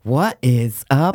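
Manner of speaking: slow, drawn-out showy hello; put-on greeting voice. Co-host acts someone opening the next episode, slow affected greeting. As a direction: stretch each word, an effortful put-on voice. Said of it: "but that's effort".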